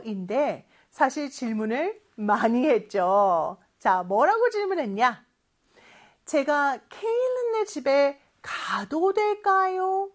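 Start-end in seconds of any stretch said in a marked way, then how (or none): 6.2-10.1 higher, drawn-out courteous question; slowly and politely ask for permission; polite request. Acted. Child asking mom to visit a friend's house, politely. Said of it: "공손하게 하면".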